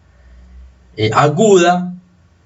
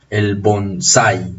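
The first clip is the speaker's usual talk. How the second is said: slow, lower model of bonsai, stressed bon-SÁI; 4 semitones lower, 3 dB quieter, much slower than usual. Shown as a demonstration. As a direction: draw out bonsai with the weight on the a. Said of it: "la pronunciación es bonsai". (bonsai pausado, con acento en la a, bon-SÁI)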